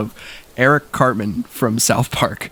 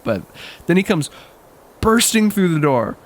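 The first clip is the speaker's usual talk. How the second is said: ordinary talk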